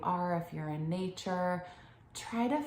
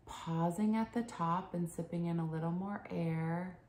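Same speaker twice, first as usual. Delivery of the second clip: soft, soothing meditation voice with long pauses; unhurried, hushed breathing instruction; guided meditation; 2 semitones lower, 4 dB quieter than usual. Acted. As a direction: guide them gently into calm, phrase by phrase.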